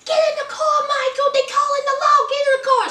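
screeching at her man to get in; frantic high-pitched begging; mock Sarah. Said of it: "Sarah's screaming for Michael".

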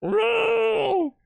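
very high panicked protest; freaked-out wailing. Shown as a demonstration. Player showing how game gets them, freaked-out wail.

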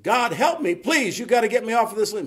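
high, loud, hurried begging; frantic pleading. Retold or imitated. Man on a limb begging God for rescue, high and frantic. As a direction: beg in mock panic.

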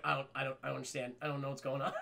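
low, very soft, lost muttering; bewildered murmur. Acted. Jack silent among talking dogs, quietly bewildered. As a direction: mumble it low, utterly confused.

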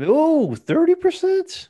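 high, slow, astonished; mock amazement. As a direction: ham up disbelief at the deal.

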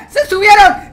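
loud alarmed yell; excited shout. Real, not acted. Monsters climbing into their house.

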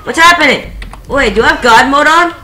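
loud, high alarmed yelling; panicked shouting. Real, not acted. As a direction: yell in sudden panic. Gets shot in game.

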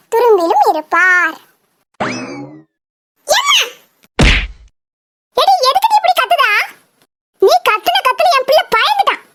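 ordinary talk

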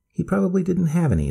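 put-on high, eager old lady; grandma voice. A narrator's reading. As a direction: point it out like a delighted grandma. Grandmother explains.

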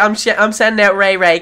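ordinary talk, nothing marked

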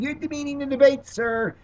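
high, fast mock screech of an indignant lady; a lady in the audience screaming at the candidate, mock screech. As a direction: squeal it out like the offended lady. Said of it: "some lady screamed".

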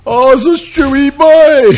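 high, slow, put-on voice of the boy; mimicking the boy.

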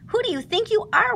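lightly voiced gopher, pitched high; a whistling gopher voice, up high. A narrator's reading. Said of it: "whistled Gopher".

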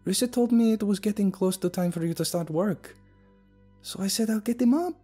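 high, worried voice; a hint of worry building. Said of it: "began to sound generally worried".

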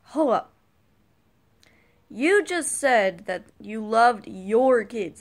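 slow, loud pointed accusation; indignant callout. Retold or imitated. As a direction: spell it out to catch them. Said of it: "calling out the parent".

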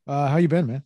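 ordinary delivery

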